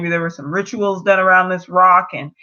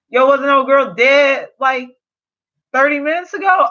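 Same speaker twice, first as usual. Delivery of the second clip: disbelief; 7 semitones higher, 4 dB louder than usual. Real, not acted. Viewer reacts to dead character.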